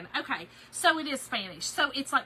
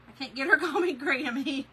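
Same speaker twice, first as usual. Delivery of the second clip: ordinary talk, nothing marked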